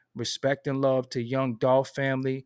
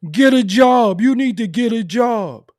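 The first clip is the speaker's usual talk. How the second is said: much higher, louder mocking of hecklers; mock yelled demand; 9 semitones higher, 11 dB louder than usual. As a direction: bark the advice like an angry commenter. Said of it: "they be saying".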